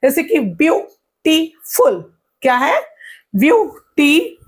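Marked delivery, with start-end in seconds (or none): 0.4-2.1 "beautiful" in three beats, view, टी, फुल; short pause after view and after टी; split into syllables. Shown as a demonstration. Teacher introducing three syllables, beautiful broken in three. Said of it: "अब तीन में". 3.4-4.4 "beauty" as two separate beats, view, टी; split into syllables. Shown as a demonstration. Teacher starting a syllable count, beauty broken in two. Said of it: "इसमें तीन syllable है".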